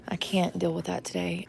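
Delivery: tired, whispered give-up; exhausted whisper to viewers; weary whisper. Real, not acted. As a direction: breathe it out, done. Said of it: "exhausted whisper".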